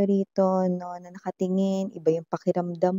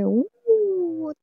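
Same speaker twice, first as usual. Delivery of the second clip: delighted ooh; 10 semitones higher than usual. Real, not acted. Reacting to Two of Cups card.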